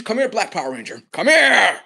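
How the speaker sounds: a loud, high shout of come here; calling out. Real, not acted. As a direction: summon someone from far off.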